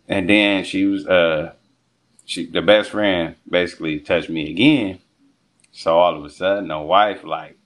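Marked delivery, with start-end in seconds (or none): none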